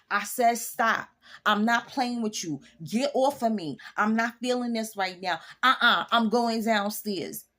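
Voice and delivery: mean every word of the stop; stern no. Shown as a demonstration. Wife to husband, a loud firm red-light refusal. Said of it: "red light is".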